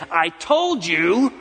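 slow, drawn-out scolding; a weary parent scolding a child; exasperated parent. Retold or imitated. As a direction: drag it out like a fed-up parent. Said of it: "You get exasperated and frustrated".

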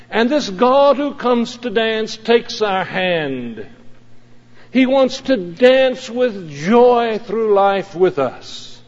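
slow, swelling preacher's cadence; impassioned preaching. Acted. Pastor preaching to the congregation, fervent. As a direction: build it to a fervent climax.

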